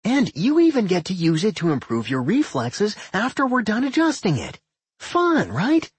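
high, gleeful sales pitch; cheerful. A narrator's reading. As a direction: sell it cheerily, high and eager. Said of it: "Doc said cheerfully".

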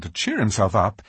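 ordinary delivery

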